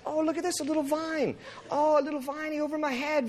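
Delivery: high-pitched mock delight; Jonah gushing happily. Jonah admiring his little vine, giddily happy. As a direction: coo over it in a hammed-up high voice. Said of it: "and he's happy".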